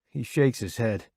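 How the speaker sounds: ordinary delivery, nothing marked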